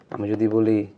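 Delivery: ordinary talk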